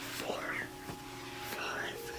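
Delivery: ordinary talk, nothing marked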